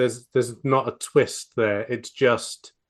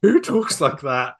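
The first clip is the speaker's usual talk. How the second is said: incredulous outburst; 9 semitones higher, 4 dB louder than usual. Retold or imitated. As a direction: stretch it out in baffled disbelief. Incredulous reaction to stilted villain line.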